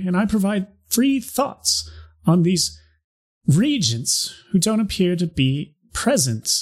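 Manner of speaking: high, ringing proclamation voice; town crier. Acted. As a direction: announce it like a town crier in the square. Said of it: "I'm just gonna start it from the beginning".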